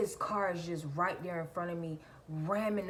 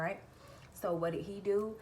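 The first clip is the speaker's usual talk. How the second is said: usual speaking voice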